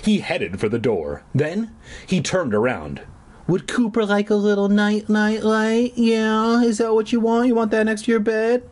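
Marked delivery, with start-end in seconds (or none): 3.5-8.6 high, mocking baby talk; sustained squeaky-high baby voice; tiny baby voice. A narrator's reading. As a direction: taunt him as if talking to a baby. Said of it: "in a tiny baby voice".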